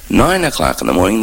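normal talking voice

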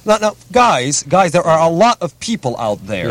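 mock-concerned, drawn-out lecture; voice of responsibility. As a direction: plead with the guys to be responsible. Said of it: "I always play the voice of responsibility".

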